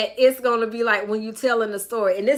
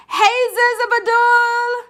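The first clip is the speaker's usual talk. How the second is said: high, loud, stretched-out hello; exuberant greeting; 11 semitones higher, 7 dB louder, slower than usual. Real, not acted. Host hailing a viewer, loud high drawn-out call. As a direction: sing out the name slowly.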